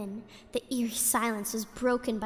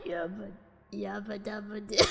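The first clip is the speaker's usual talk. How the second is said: ghostly low voice; 3 semitones lower, 9 dB quieter than usual. Acted. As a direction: draw it out like a ghost. Ghostly catchphrase again.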